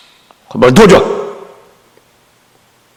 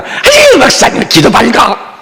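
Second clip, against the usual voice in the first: high, coy "아유" from a churchwoman; teasing deaconess; 6 semitones higher than usual. Retold or imitated. Deaconess teasing the pastor as she leaves, high and coy. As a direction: squeal it up high, playfully. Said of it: "또 그냥 안 가".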